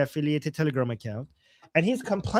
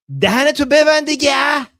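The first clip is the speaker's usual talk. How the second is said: yelling, high and loud; angry shout; 7 semitones higher, 9 dB louder, much faster than usual. Retold or imitated. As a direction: bark it like a furious fan. Acting angry comment, shouted.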